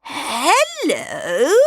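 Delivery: lightly voiced old lady greeting; a sweet false old-woman hello; a hint of a disguised old lady. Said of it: "said the old lady".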